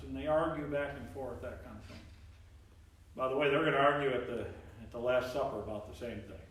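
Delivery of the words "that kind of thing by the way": ordinary talk, nothing marked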